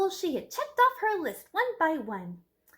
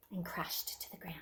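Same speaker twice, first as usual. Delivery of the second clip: hushed crash; 7 semitones lower, 8 dB quieter than usual. A narrator's reading. The copter crashes.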